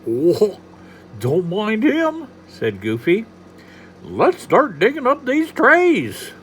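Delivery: high, slow goofy drawling voice; passable Goofy. Acted. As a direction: do a dopey cartoon Goofy. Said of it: "My Goofy's passable".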